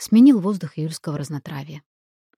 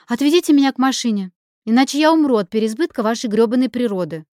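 louder, higher, fed-up order; exasperated demand; 6 semitones higher, 6 dB louder than usual. A narrator's reading. Lina ordering the botanists to take her to the car, exasperated. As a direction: demand it with emphatic irritation.

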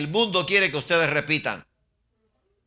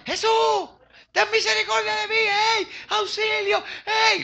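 very high, pleading yell; crying out for mercy; 4 dB louder, slower than usual. Acted. Blind man shouting to Jesus for mercy, high desperate yell. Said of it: "¿Qué gritaba?".

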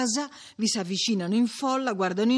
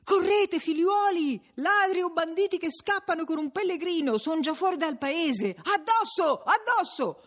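high, rallying cry; urgent shouting; 10 semitones higher than usual. A narrator's reading. Newcomer urging villagers after the fleeing bandits, shouting. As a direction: shout the crowd into giving chase. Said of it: "arriva un altro che ... e grida".

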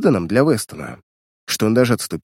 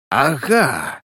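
drawn exclamation of recognition; a touch of fury, not a full shout; enraged cry; 2 semitones higher than usual. A narrator's reading. Weston recognising his old victim, raging. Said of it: "вне себя от ярости закричал Вестон".

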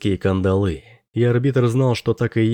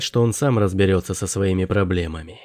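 ordinary delivery

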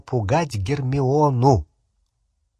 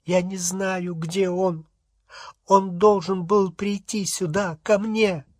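high, tearful wife in distress; acted weeping, high-pitched; 6 semitones higher than usual. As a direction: plead through tears in a raised, fragile pitch. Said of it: "Миссис Кроткот заплакала".

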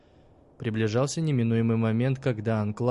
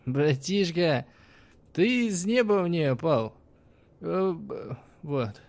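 put-on slow, slurred drunk voice; 6 semitones higher, slower than usual. A narrator's reading. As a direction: slur it like a drunk.

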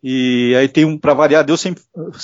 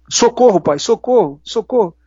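cry for help; 6 semitones higher, slower than usual. Retold or imitated. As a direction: imitate a frightened child calling her father. Daughter cries for help. (filha gritando socorro para o pai, imitado)

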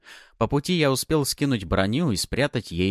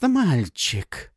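breathy, drawn-out мальчик; 7 semitones higher, 3 dB louder than usual. Acted. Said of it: "Последнее слово она произнесла с придыханием".

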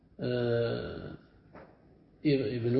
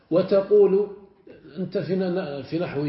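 ordinary delivery

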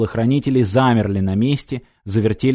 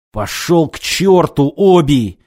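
slow, higher, loud angry bark; barking in helpless rage; 4 semitones higher, 4 dB louder, slower than usual. A narrator's reading. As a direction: snap back in powerless fury. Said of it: "в бессильной злобе рявкнул".

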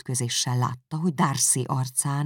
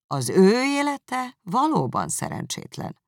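scornful sneer; 10 semitones higher, 5 dB louder, slower than usual. Acted. Darcy sneers.

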